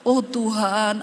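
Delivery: ordinary talk, nothing marked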